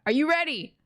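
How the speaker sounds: ordinary delivery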